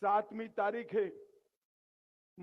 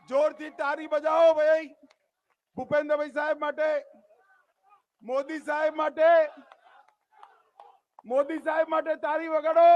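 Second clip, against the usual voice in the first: loud, raised call for applause; rallying call; 4 semitones higher, 8 dB louder than usual. Real, not acted. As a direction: call out to the crowd to clap. Rally speaker calling for applause. (ભીડને તાળી માટે ઊંચા અવાજે હાકલ)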